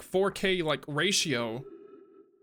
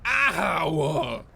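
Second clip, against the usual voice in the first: louder sharp yelp; 4 dB louder than usual. Real, not acted. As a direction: cry out at the hit. Character hit in game.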